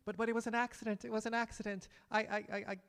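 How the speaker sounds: high, stammering, desperate voice begging for his life; a terrified man tripping over his words; panicked pleading camel driver. Acted. Camel driver hears his sentence.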